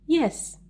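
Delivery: Yes, pitched high with emphasis; intonation on Yes. Shown as a demonstration. Reading a sample answer to students, emphatic high Yes,. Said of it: "you should use intonation".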